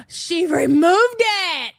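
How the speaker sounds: slow, high gasping disbelief; stunned she deleted it; gasping shock. Real, not acted.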